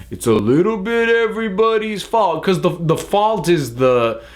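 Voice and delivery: high, sing-song mock of a cop-out moral; mock shrug. Reviewer parodying a bland both-sides message, high sing-song mock. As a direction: toss it off in a breezy, mocking voice. Mocking a wishy-washy moral.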